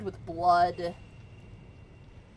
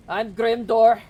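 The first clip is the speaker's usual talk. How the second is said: loud dwarf introducing himself; gruff guard; 5 semitones higher, 12 dB louder, slower than usual. Acted. Duergar guard introducing himself to party, loud and proud. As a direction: announce your name proudly, loud. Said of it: "he says".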